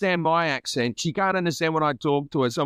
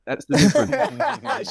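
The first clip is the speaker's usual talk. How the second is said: laughing admission; 4 semitones higher, slower than usual. Real, not acted. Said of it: "if I was drunk".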